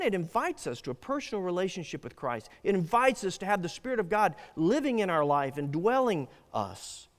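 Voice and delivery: normal talking voice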